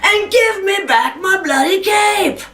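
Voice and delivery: high, emphatic outburst; forceful demand. Acted. Jack demanding his cape from the devil, high and forceful.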